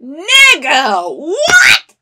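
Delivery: high, incredulous yelp; shocked outburst. Real, not acted. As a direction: blurt it out in disbelief, rising. Shocked reaction to show.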